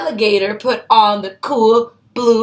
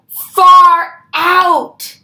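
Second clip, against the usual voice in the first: high, loud delighted burst; higher and louder, thrilled; delighted exclaim; 6 semitones higher, 5 dB louder than usual. Acted. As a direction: cry out in amazement. Turtle delighted by glasses.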